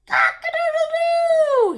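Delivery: very high, loud rooster crow; horse crowing. A narrator's reading. Horse crows in the morning sun, loud. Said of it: "Horse ... crowed".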